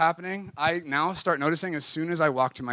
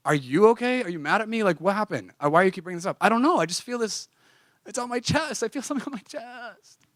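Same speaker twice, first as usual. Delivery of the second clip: anxious pleading; 8 semitones higher than usual. Retold or imitated.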